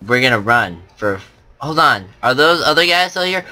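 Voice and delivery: ordinary delivery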